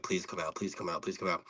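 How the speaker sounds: soft, low, repeated begging as if praying for luck; hushed pleading. Retold or imitated. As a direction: plead quietly under your breath. Anxiously waiting for the pull.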